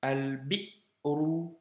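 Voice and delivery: Al-bi'ru read slowly, glottal stop before the u; Arabic reading demo; slow reading model. Shown as a demonstration. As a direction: stop the breath between bi and ru in Al-bi'ru. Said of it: "Donc, alif lam".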